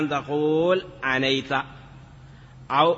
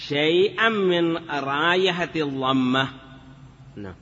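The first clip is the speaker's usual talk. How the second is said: ordinary delivery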